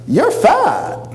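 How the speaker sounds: high, flat mock mother voice; mother's blunt verdict. Mother greeting her son with a blunt high put-down. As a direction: snap it out like a blunt mom.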